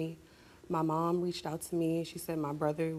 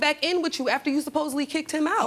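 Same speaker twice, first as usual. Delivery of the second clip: real shouting match, much higher and louder; furious shout; 10 semitones higher, 5 dB louder, faster than usual. Real, not acted. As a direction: yell it at her mother, furious. Heated argument with her mother.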